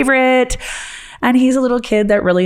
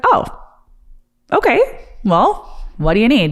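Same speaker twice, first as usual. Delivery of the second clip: lightly voiced kid, rising high on okay; a child eagerly offering help; son's voice; 6 semitones higher than usual. Son voiced in story.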